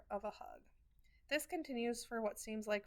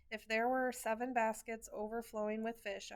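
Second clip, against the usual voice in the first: ordinary delivery, nothing marked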